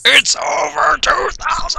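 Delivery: high, drawn-out mock shout; hammed-up battle cry; catchphrase shout. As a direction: stretch it out in an over-the-top high yell. Parodies a famous shouted catchphrase.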